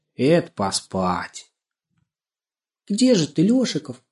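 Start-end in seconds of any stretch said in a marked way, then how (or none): 2.9-4.0 put-on high, sweet girl's call; ringing, affectionate. A narrator's reading. Girl calling her little friend, ringing and affectionate. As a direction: call out fondly to a hidden friend. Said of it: "Звонко и ласково позвала".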